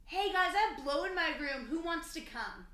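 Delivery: imitated drunk invitation. Drunk husband to young boys, imitated chummy call. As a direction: call it out like a wasted party host.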